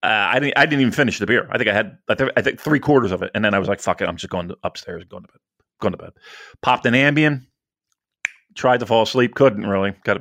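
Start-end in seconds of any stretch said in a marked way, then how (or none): 3.8-6.1 low, quiet, giving up; defeated mutter. Retold or imitated. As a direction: mutter it, beaten and tired.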